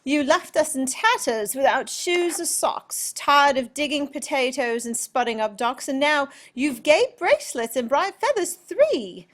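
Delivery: put-on high, loud British country girl; British accent. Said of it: "break into the British accent again".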